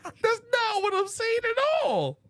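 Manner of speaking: squeaky high protest; high strained protest. Real, not acted. Heated podcast argument.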